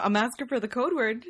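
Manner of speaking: ordinary talk, nothing marked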